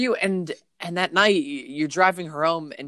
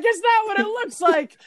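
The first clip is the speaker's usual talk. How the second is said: put-on caught girlfriend; 5 dB louder than usual. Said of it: "And she's like".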